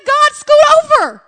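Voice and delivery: high, loud, flippant "scoot over"; brushing God off. Retold or imitated. Preacher voicing believers shooing God off his throne. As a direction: wave God off the seat carelessly. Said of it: "we said".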